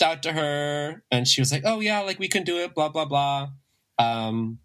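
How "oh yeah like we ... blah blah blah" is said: higher-pitched imitation of an easygoing yes; quoted casual go-ahead, pitched up; breezy agreement. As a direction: toss it off high, as someone agreeing readily. Quoting her easy agreement.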